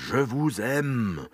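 slow, drawn-out mock French; affected French lover; French phrase. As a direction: savour the French words slowly. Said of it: "würde ich sagen".

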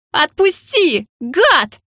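high-pitched outraged cry; angry yelling. A narrator's reading. Heroine slung over his shoulder, yelling. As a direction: yell to be let go. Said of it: "раскричалась я".

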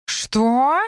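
high, rising shout of disbelief; drawn-out, indignant "what?"; outraged yell. A narrator's reading. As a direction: yell it as an incredulous question. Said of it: "заорал Морфеус".

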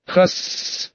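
breathy unvoiced hiss; ghostly hiss. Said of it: "Смех, усмешка, боевой клич?".

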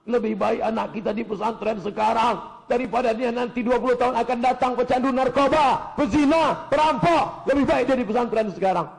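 high, mounting acted scolding; angry husband. Husband scolds wife for crying over son, acted anger building. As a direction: scold with rising force, hitting each item. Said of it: "Suaminya marah".

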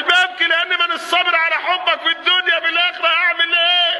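high, sustained cry of longing; acted anguished plea. Servant telling the Prophet why he weeps, anguished. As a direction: plead at a high, held pitch.

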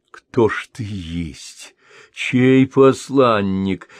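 slow, rattled questioning; losing composure. A narrator's reading. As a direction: voice slipping out of control as she asks. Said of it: "теряя самообладание". (растерянно, медленно, теряя самообладание)

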